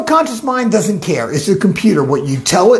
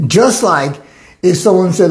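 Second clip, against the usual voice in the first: normal talking voice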